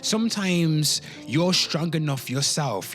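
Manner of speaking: usual speaking voice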